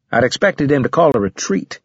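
ordinary delivery

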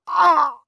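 high, loud cry of pain; sharp pain cry. A narrator's reading. Mother struck by stomach pain, sharp cry. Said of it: "sudden stabbing pain through her stomach".

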